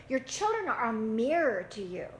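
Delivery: normal talking voice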